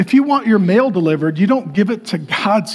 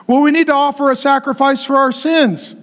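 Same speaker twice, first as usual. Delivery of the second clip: high-pitched mock insistence; put-on plaintive voice, held high; anxious religious people; 7 semitones higher than usual. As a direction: mimic people fretting over what they lack. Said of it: "People are like".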